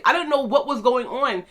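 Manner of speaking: ordinary talk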